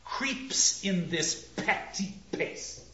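slow, with crisp p and t in "petty pace"; spat-out contempt. Shown as a demonstration. Actor has to spit the words out, spat-out contempt. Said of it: "It is contempt".

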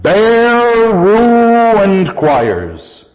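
"Bare ruined" both stretched long and pitched high; double accent. Shown as a demonstration. Teacher shows a spondee, "Bare" and "ruined" both heavily stressed. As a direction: hold "Bare" and "ruined" as two equal heavy stresses. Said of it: "that double accent".

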